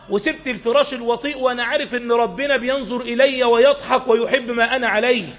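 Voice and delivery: ordinary delivery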